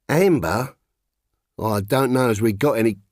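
put-on a faded shopkeeper in a light London accent, rummaging; gentle London accent, searching the shelves; lightly common London accent. A narrator's reading. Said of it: "How bad her accent had got".

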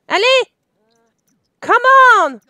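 loud, high shout at the screen; impatient urging. Real, not acted. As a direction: hurry them along with a sharp yell. Urges the game character to hurry.

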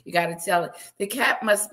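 ordinary delivery

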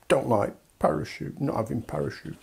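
put-on soft, pouting toddler refusal, fading out; sulky toddler. UK as toddler refusing the parachute, pouting refusal. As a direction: grumble it like a stubborn small child.

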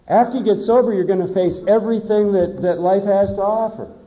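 loud, high, driving declaration; emphatic preaching. Real, not acted. Speaker warning newcomers about sober life, loud emphatic preaching. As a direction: raise the voice and hammer the warning home.

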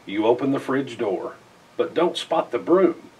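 ordinary delivery, nothing marked

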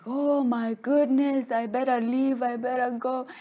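high, anxious fretting; acted alarm. Acted fright, deciding to flee.

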